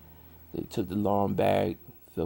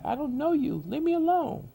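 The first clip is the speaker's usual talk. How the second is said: much higher, louder woman's voice telling him off; high frightened Trisha; 5 dB louder than usual. Retold or imitated. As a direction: play her pushing a stranger away through the door. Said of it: "And she was like".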